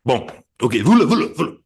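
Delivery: high, loud cartoon French accent; hammed-up French. Playing Guy Lestrange with a bad French accent. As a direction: ham up a fake Frenchman. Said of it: "a really shit French accent".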